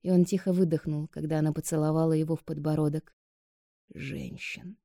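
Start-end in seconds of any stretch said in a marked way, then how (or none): 3.9-4.8 soft rising correction; quiet. A narrator's reading. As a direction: murmur it teasingly.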